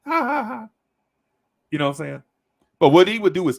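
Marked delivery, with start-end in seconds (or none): 0.1-0.7 high mock chuckle from the advisor; ha-ha it out in a put-on voice; imitated laugh. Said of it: "He laughed".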